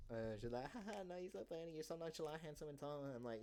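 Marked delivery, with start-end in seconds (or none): 0.7-3.0 lightly voiced giggling client; mock Sarah. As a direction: gush like a smitten client. Voicing Sarah flirting back.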